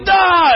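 high, loud yell of frustration; angry high shout; frustrated yell. Retold or imitated. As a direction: let out a fed-up yell. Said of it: "and I'm like".

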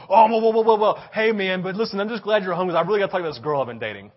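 loud, fast imitation of a friend cutting in; mock interrupting friend. Friend interrupting the pastor's trip story, loud mock whoa. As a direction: barge in with hammed-up whoas, then rush the news. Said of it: "he goes".

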